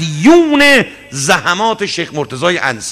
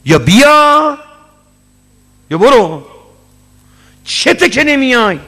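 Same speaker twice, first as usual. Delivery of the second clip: higher, louder stern demand; angry ultimatum; 6 semitones higher, 3 dB louder than usual. Retold or imitated. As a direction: give him the choice sharply. Sheikh scolds irregular student. (استاد با تندی به شاگرد: یا بیا یا برو)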